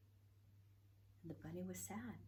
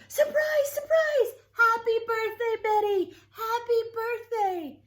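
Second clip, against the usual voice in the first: much higher and louder, a gleeful group yell; surprise shouts; 15 dB louder, slower than usual. Acted. Friends jumping out at Betty's surprise party, shouting. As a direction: burst out yelling surprise at the party. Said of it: "Betty heard shouts from all over".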